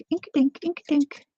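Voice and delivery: high playful singsong chant. Real, not acted. Crafter chanting playfully to herself while inking. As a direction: chant it like a little tune.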